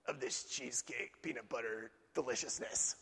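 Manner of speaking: low, soft, savoring; dreamy swoon. Retold or imitated. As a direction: drift off longing for the cake.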